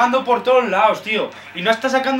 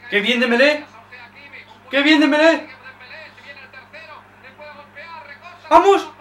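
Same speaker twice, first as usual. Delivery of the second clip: loud, high cheering; excited cheer; 7 semitones higher, 4 dB louder than usual. Real, not acted. Fan cheering Dembélé's run with a loud, high shout. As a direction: shout the winger on.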